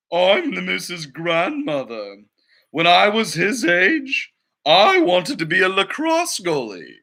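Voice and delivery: slow, a hint of an old lady; lightly voiced old grandmother. Grandmother telling the director her dream, lightly voiced. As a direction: proudly reminisce as a spry grandma.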